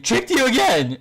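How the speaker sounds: gleeful shout. Retold or imitated. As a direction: crow over the repeated trick. Lee tricks Kimimaro again.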